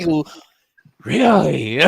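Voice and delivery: loud, high-pitched "really?"; exaggerated incredulous "really?"; mock disbelief. Host recalling her own reaction to losing track, with mock disbelief. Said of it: "I'm like".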